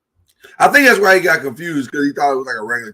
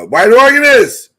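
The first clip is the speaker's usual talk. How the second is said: calling out; 9 semitones higher, 11 dB louder, slower than usual. Real, not acted. Calling the organist to play.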